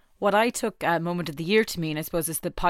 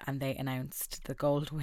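ordinary talk, nothing marked

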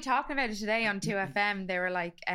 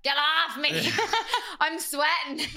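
higher, louder comic complaint; mock rebuff; 5 semitones higher, 4 dB louder, slower than usual. Fending off a lover, comic loud protest. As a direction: push them away with a laughing yell.

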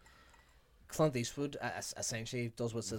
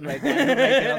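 mock Chucky voice; 11 semitones higher, 15 dB louder, slower than usual.

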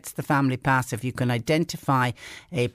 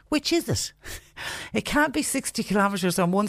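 incredulous; 7 semitones higher than usual. Real, not acted. Baffled by the road signs.

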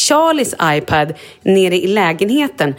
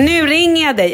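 ivrig utrop; 9 semitones higher than usual. Retold or imitated. Kollegan retad i återberättelse.

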